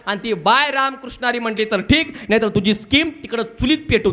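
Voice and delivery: ordinary talk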